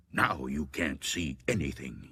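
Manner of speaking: low, soft, half-whispered; hushed gravedigger. A narrator's reading. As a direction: mutter it low to the corpse. Gravedigger addresses the dead woman.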